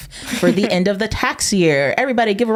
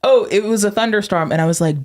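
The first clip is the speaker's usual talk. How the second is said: ordinary talk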